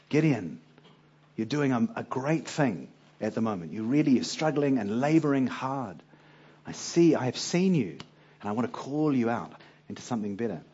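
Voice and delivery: soft, gentle voice of encouragement; gentle reassurance. Pretend. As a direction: reassure him softly, winning him over. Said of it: "start with something gentle".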